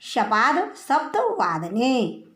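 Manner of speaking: शपाद सप्त वादने spoken slowly, each word spaced apart; slow, careful recitation. Shown as a demonstration. Teacher dictating the Sanskrit time phrase to students, slow and careful. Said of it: "तो लिखेंगे उसको".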